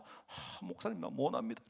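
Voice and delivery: sighing, softly reluctant member; reluctant refusal. Acted. Acting out members declining a task.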